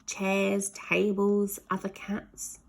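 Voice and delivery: ordinary delivery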